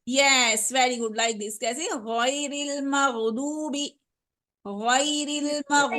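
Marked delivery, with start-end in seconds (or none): 2.1-6.0 गैरिल मग़दूबी stretched slowly; slow model reading. Shown as a demonstration. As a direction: read गैरिल मग़दूबी slowly for the student to copy. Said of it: "मुझे सुनिए अच्छे से". (धीमे, साफ़ गैरिल मग़दूबी)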